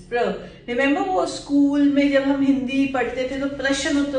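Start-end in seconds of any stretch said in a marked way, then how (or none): 0.1-0.2 clipped pr sound; syllable pr. Shown as a demonstration. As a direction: say just pr, no vowel. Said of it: "वो प्र का देना है".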